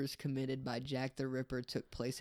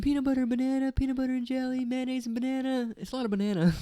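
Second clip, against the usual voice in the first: higher and louder listing; high loud playful list; silly excited list; 10 semitones higher, 8 dB louder, faster than usual. Pretend. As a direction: rattle off the sandwiches gleefully.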